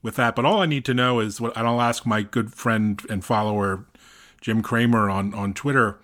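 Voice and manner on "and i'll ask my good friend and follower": ordinary talk, nothing marked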